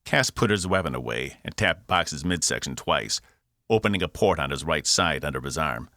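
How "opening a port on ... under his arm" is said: plain reading voice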